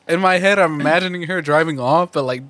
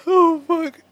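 ordinary delivery

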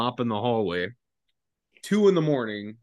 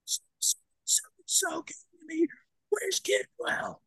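high, whispered slurred voice; breathy high caricature of a drunk boss; hushed high drunk sergeant; mock drunk sergeant; 4 dB quieter, much slower than usual.

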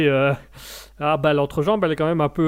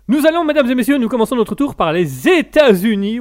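higher, louder presenter voice; grand host announcement; 6 semitones higher, 7 dB louder than usual. Acted. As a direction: present it like a showman. Announcing first news item.

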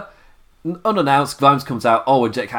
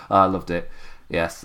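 ordinary delivery

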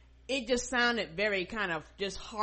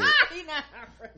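louder, higher, laughing through it; laughing mock quote; 6 semitones higher, 6 dB louder than usual. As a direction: repeat it mockingly while cracking up. Mocking repeat of Daryl quote.

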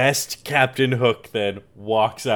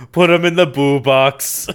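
loud pirate captain's order; mock command; 8 dB louder than usual. Host as Hook sentencing crying pirate, loud command. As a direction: pronounce the silly sentence loudly, with mock menace.

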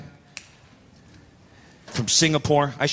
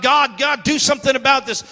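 raised, pleading prayer; crying out; 7 semitones higher than usual. Retold or imitated. Pastor praying in his disgrace, crying out. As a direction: cry up to God in a higher voice. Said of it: "began to cry out to God".

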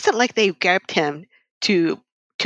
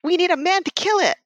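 quoting the friends; 8 semitones higher than usual. Retold or imitated. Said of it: "And it's like".

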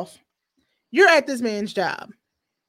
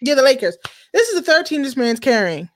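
ordinary delivery

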